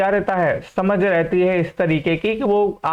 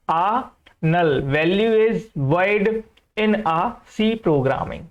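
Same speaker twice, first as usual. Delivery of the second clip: very slow reading; much slower than usual. Shown as a demonstration. Teacher reading the example sentence to students, very slow. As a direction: read the example slowly, word by word. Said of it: "teacher reads example sentence".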